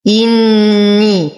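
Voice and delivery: slow إني with long hummed double nun; full ghunna. Shown as a demonstration. Teacher reciting for students, إني with full nasal ghunna. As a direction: hold the nun of إني through the nose. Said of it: "نشد النون بغنة أكمل ما تكون".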